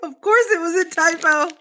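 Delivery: high, loud, laughing exclamation; laughing exclaim. Real, not acted. Laughing at a viewer's typo, high and loud.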